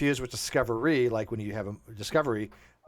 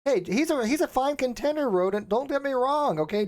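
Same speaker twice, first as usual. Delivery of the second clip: high mock voice; 11 semitones higher than usual. Mock defensive reply to donor.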